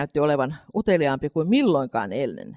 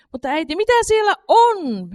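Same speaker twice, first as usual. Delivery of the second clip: Katri asking; 14 semitones higher, 7 dB louder than usual. A narrator's reading. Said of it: "hän kysyi pöytällä äänellä".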